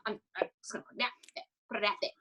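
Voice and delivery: fast, breathless whisper to herself. Retold or imitated. Whispering her plan to message Scott to herself. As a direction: rush it out in a hushed whisper. Retelling own inner decision.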